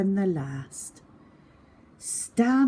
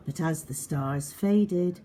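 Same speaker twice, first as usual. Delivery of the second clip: soft, whispery suspense narration; hushed storytelling; 5 dB quieter than usual. A narrator's reading. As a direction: draw the children in with a secret hush. Hushed ending.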